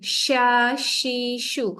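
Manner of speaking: "Sha, shi, shu" with a hissed sh; letter drill. Shown as a demonstration. Teacher drilling the shin syllables slowly. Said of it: "ini lebih rasa".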